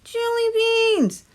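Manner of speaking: high, slow squeaky tooth voice; muffled tooth voice. A narrator's reading. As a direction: draw it out like a tiny hidden creature. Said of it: "said a muffled voice".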